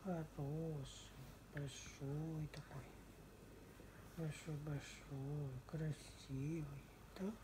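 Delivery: slow, hushed cooing. Real, not acted. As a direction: coo softly to a sleepy pet. Cooing to the drowsy pet.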